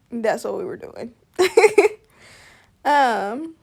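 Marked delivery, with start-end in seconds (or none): 1.4-1.9 short loud laugh. Real, not acted. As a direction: burst out laughing. Laughing after the remark.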